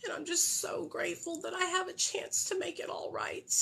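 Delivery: whispered through near tears; tearful whisper. Real, not acted. Recovering mother whispering thanks on her sober anniversary. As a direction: barely voice it, overcome.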